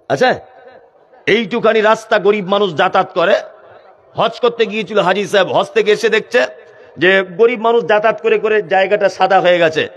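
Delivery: ordinary talk, nothing marked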